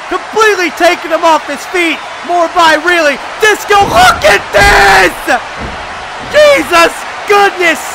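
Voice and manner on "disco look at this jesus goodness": amazed shout. Real, not acted. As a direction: yell it out like you can't believe your eyes. Commentator amazed by big move.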